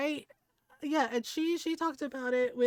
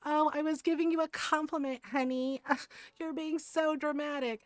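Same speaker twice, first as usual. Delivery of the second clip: sing-song mock of a condescending man, pitched up; mocking the guy; 4 semitones higher than usual. Mocking the friend's date brushing her off as dramatic. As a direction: parody his smug brush-off, a bit higher. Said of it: "of course he pulled out the".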